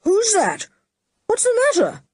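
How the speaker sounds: a worried shout from afar; man calling to a frightened girl, anxious. A narrator's reading. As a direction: call out high and worried. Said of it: "an anxious voice calling".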